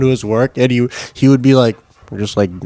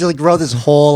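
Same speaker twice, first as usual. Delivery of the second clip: normal talking voice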